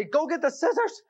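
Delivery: yelling for the scissors while stuck upside down; retold scream. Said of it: "I'm screaming at Carrie".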